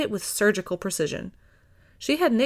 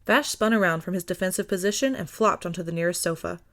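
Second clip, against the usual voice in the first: plain reading voice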